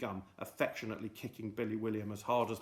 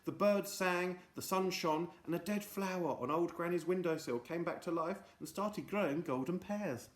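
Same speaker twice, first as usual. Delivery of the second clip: plain reading voice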